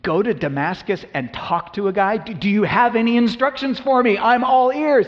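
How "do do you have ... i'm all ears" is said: loud, high, impatient eagerness; Paul demanding more from Jesus; eager pressing. Acted. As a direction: push for answers, all ears.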